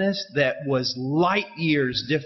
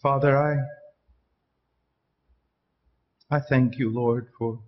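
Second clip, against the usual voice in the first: prayer; 5 semitones lower than usual. Real, not acted. Closing prayer, low and soft.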